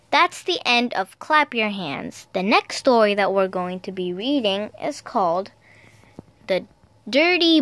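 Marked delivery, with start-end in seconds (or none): none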